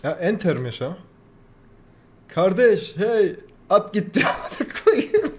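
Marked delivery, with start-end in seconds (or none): 2.3-5.3 slow, high, airy laughing curse. Real, not acted. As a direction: laugh in disbelief at the loss. Horse ran off, laughing. (gülerek sövme)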